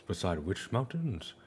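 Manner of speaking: usual speaking voice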